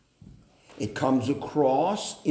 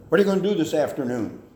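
louder, fast interrogating demand; a parent interrogating the child, louder and sharp; harsh demand; 2 semitones higher, 5 dB louder, much faster than usual. Shown as a demonstration. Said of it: "rather than".

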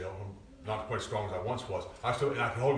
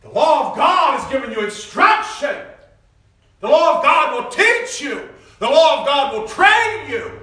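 higher, louder, slow hammering sermon; preaching at full force; 17 dB louder, slower than usual. Real, not acted. Preacher thunders the law of God at the church. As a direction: pound each line from the pulpit.

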